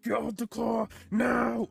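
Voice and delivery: high, loud, angry imitated shout; mad police order. Officer losing patience with driver at the window, imitated angry shout. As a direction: bellow the command, landing hard on the last word. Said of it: "he starts getting mad".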